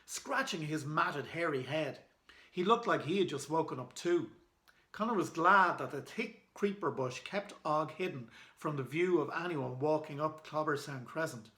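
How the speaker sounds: ordinary delivery, nothing marked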